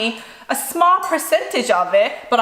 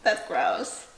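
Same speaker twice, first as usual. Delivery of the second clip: tender teasing; 5 dB quieter than usual. Real, not acted. Teasing child, rubbing sweat.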